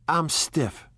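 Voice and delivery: understated whine of complaint; complaining. A narrator's reading. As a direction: grumble lightly about your discomfort. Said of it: "she complained".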